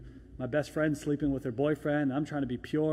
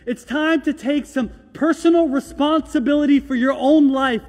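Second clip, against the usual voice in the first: loud, ringing sermon delivery, much higher; full-force preaching; 10 semitones higher, 15 dB louder than usual. Acted. Pastor urging the congregation to move on, preaching loud. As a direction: preach it out to the whole room.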